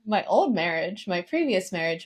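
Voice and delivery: usual speaking voice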